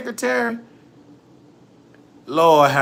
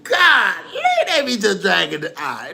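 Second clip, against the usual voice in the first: ordinary talk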